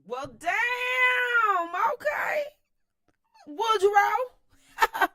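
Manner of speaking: high, loud disbelieving yell; outraged shout. Real, not acted. Outraged reaction to Wilson.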